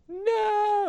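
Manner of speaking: a very high, drawn-out hammed-up "No!"; Luke's anguished no. Shown as a demonstration. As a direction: wail "No!" like Luke learning the truth. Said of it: "And Luke's just like".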